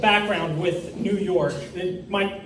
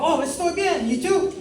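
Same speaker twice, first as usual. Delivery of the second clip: much higher, put-on Spanish reply; answering in Spanish; 10 semitones higher than usual. Comic answering the old lady in high Spanish. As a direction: answer brightly in careful Spanish. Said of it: "And I go".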